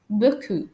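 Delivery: beaucoup said "bo-koo", no P; silent final P. Shown as a demonstration. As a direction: end beaucoup on the vowel, leaving off the P. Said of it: "I don't pronounce the letter P".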